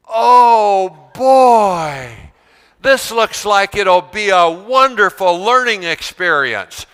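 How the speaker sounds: mock enthusiasm. Shown as a demonstration. Teacher showing students how to cheer for a handout, hammed-up joy. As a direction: gush over the handout. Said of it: "This is what I want you to do".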